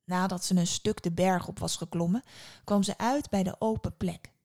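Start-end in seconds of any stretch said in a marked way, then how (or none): none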